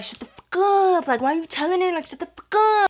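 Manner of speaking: high, squeezed telling-off of a snitch; imitated panicked teammates; mock urgent hushing. Teammates silencing the snitch, retold.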